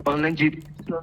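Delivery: choppy call audio, words dropping; bad connection, voice breaking up; voice cutting out. Real, not acted. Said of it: "ये थोड़ी देर break कर रही है आपकी आवाज़".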